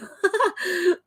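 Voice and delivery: high squealing laugh. Real, not acted. Streamer laughs at a viewer's drawing joke.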